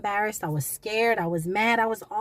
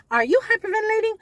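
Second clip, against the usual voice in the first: much higher, fast, louder alarmed question; mock inner voice; 13 semitones higher, 7 dB louder, faster than usual. Her own mind asking her, high and alarmed. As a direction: squeak it like a startled inner voice.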